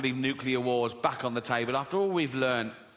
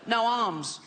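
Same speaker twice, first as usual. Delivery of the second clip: put-on high, strained little-boy voice; a frail kid squeezing the words out; Timmy's funny voice; 7 semitones higher than usual. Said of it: "He's got a funny little voice".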